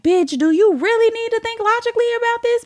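high, squeezed, incredulous whine; squeaky inner voice objecting; protesting coochie. Acted. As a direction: question it in a rising high voice. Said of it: "my coochie really just be like".

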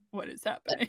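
hushed, breathy mock confusion; whispered confusion. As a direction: whisper it like a baffled listener. Imitating confused radio listeners.

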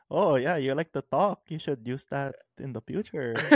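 loud, sarcastic mock-encouragement; sarcastic mom. Friend imagining mom's reply to a talkative kid, sarcastic. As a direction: act out a mother humoring the kid with sarcasm. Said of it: "saying it sarcastically".

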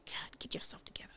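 harsh whispered rebuke. Acted. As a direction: hiss it under your breath. Hissed rebuke to coworker.

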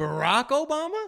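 high, loud, slow incredulous echo; can't believe what he just heard; stunned disbelief. Real, not acted. Friend echoing the name in high, loud disbelief.

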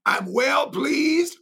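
high, slow ringing refrain; preached refrain. Real, not acted. Preacher quoting God to the church, slow and high. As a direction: stretch it out and lift it high. Preacher repeats God's words.

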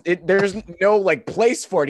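ordinary talk

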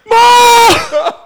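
very high, loud wail for mom; hammed-up bawling cry; mock crying. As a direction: wail like a distraught teenager. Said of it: "he's, like, crying".

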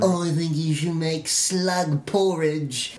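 slow, put-on royal busybody; hovering king. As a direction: play a meddling king breathing down someone's neck. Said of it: "king breathing down your neck".

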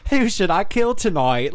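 high, lilting mock diarist; Bridget Jones diary voice. Imagining a serial killer as a rom-com diarist, high mock-earnest voice. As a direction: muse like a rom-com heroine writing her diary. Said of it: "as Bridget Jones's Diary".

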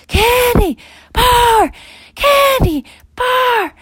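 very high, slow, loud calls into the woods; frantic parents calling for lost kids. Acted. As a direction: yell the names desperately, stretched out. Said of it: "calling in the woods frantically".

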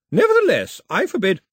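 high, loud commanding refusal; imperious forbidding. Acted. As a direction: lay down the law. Grandmother forbidding the trip.

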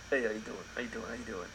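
put-on half-hearted Jersey accent, quiet; a soft stab at a Jersey Shore greeting; badly faked accent that comes out Jersey. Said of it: "that's like Jersey Shore".

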